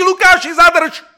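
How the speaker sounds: a loud, high shout across the hall. Acted. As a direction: yell it to stop the wedding. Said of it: "vbíhá do toho sálu a křičí".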